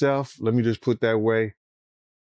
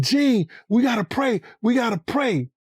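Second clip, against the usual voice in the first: urgent shouted plea; 9 semitones higher than usual. Retold or imitated. Puffy at hospital shouting.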